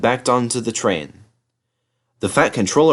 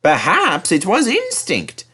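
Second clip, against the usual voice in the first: higher, slow, innocent cheek; bright teasing; 10 semitones higher than usual. A narrator's reading. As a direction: tease him sweetly. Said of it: "said James brightly".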